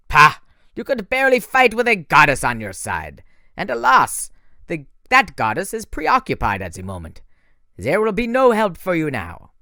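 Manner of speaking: mock the boy's weak boast; scornful French villain. A narrator's reading. Manticore scoffs.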